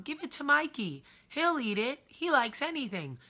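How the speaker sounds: high, loud hammed-up ad announcer; old TV commercial voice. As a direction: belt the slogan like a cheesy TV ad. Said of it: "Remember that commercial when we were young?".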